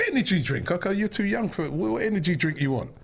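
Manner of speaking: high-pitched protest to his son; retold parental disbelief, voice lifted; incredulous dad. Acted dad objecting to son's request.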